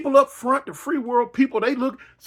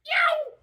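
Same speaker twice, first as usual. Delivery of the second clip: very high startled yelp. Retold or imitated. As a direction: yelp it out in shock. Sudden fright in the story.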